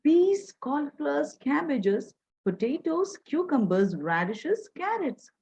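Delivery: high street vendor's cry; vegetable seller calling out. A narrator's reading. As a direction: call each item out to customers. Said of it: "calls out to his customers".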